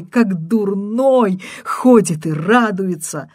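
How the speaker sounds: loud, high, tearful ranting; frantic caller. Acted. Caller berates the husband, loud and tearful. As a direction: rant at him, near tears.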